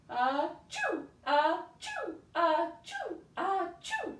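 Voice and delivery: put-on big theatrical achoos for the kids; fake sneezes. Shown as a demonstration. Teacher acts the farmer's sneezes for children, loud mock sneezing. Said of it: "he is going to sneeze".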